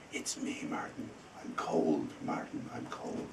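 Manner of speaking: breathy whispered ghost voice, very soft; a ghost whispering from the wall; ghostly whisper. Retold or imitated. Ghost voice in told story.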